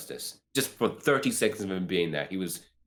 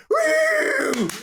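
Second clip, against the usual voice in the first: high whooping cheer; theater cheer; 6 dB louder than usual. Retold or imitated. Imitating the theater crowd, whooping high. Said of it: "everyone in my fucking theater was like".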